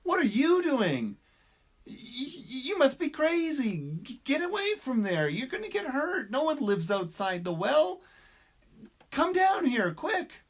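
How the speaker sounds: frantic warning to get away; panicky frog. Acted.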